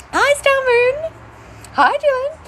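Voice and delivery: sing-song greeting. Real, not acted. Host greets a viewer in a high sing-song. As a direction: greet them like a cheery hostess.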